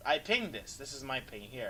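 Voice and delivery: usual speaking voice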